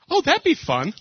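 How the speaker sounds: high eager wife voice; wife spotting the photo booth, hammed-up high; excited wife voice. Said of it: "my wife looks over at me".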